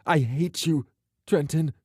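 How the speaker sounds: hurt voice near tears; a touch of a broken voice. A narrator's reading. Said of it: "she said in a broken voice".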